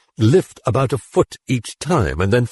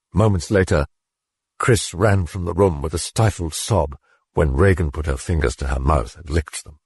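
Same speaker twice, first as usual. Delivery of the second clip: plain reading voice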